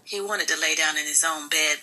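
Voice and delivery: ordinary delivery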